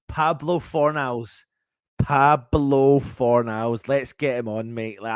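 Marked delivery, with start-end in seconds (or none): 0.1-1.4 savouring the name. Real, not acted. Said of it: "name savoured slowly". 2.0-3.9 Pablo Fornals drawn out long and louder; savoured name. Real, not acted. Fan savouring the goalscorer's name, drawn out. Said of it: "name savoured slower, louder".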